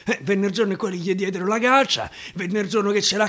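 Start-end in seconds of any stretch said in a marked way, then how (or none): none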